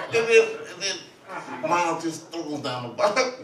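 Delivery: ordinary delivery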